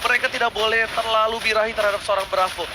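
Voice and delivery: ordinary talk, nothing marked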